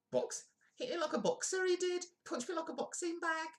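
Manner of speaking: squeaky falsetto, far above normal; mock accuser. Streamer mocking the punch accusations, squeaky falsetto. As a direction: mimic a complainer in a piercing high voice. Mimics Roxanne Pallet's accusations.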